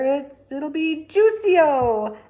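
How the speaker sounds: proudly drawn-out declaration; Mr Yarby proud voice. A narrator's reading.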